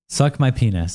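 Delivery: ordinary talk, nothing marked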